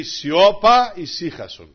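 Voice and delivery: loud high Σιώπα, then calm ησύχασον; commanding the sea. Acted. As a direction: rebuke the storm. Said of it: "Και λέει". (προσταχτικά, δυνατό Σιώπα)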